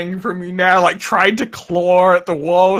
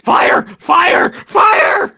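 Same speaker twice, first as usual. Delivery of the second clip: high, loud alarm cries; panicked shouting; 12 semitones higher, 5 dB louder, much slower than usual. Acted. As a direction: yell it out in panic. NPC shouting at burning player.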